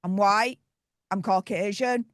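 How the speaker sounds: ordinary delivery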